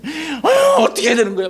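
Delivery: exasperated high outburst; acted annoyed complaint. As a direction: groan loudly at the problem, pitch jumping up. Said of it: "짜증나고 불평하기 시작했던 거죠". (짜증 섞인 높은 불평)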